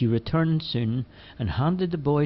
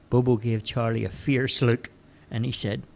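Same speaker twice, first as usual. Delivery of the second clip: ordinary delivery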